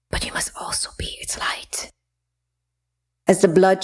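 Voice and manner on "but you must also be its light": soft unvoiced ghostly whisper. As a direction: breathe each word like a spirit. Goddess speaks from beyond.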